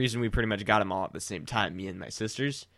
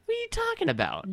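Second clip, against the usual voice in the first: high-pitched, disbelieving outburst; indignant squeal; 3 dB quieter than usual. Real, not acted. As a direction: squeak it out in protest. Indignant at being called unconfident.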